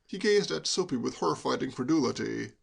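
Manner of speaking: ordinary delivery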